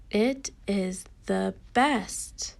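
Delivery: very slow, deliberate reading; measured, word-by-word teacher reading; slow read-along. Shown as a demonstration. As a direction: read each word slowly for young learners.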